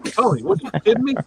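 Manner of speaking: can't believe the boss means it; disbelief. Retold or imitated. To the boss about washing the car inside, fast incredulous disbelief.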